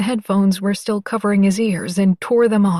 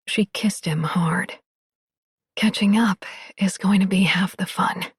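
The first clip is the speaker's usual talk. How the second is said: whispering. A narrator's reading. Narration whispered.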